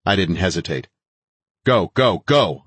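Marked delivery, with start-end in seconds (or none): 1.6-2.6 loud, higher urgent shouts; urgent command. A narrator's reading. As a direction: drive the team forward with raised voice. Urging team over corpses.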